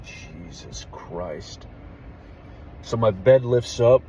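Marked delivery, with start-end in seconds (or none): 0.0-1.7 slow, hushed mock dread; hushed awe. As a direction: breathe it out slowly in disbelief. Retold terrified exclamation.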